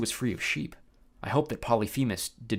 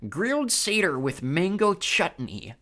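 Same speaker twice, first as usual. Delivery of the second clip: slow, high savoring voice; musing Cyclops; 7 semitones higher, slower than usual. Acted. Polyphemus tempted by the recipe, slow musing. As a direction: savor the words slowly. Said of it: "Polyphemus mused".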